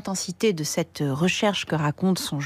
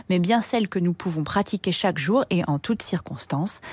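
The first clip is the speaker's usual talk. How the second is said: ordinary talk, nothing marked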